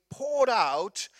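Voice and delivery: "poured out" stretched long and slow; emphasis on "poured out". Shown as a demonstration. Preacher picturing the Spirit's coming, "poured out" slowly drawn out. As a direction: draw out "poured out" with weight. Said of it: "think of that word for a moment".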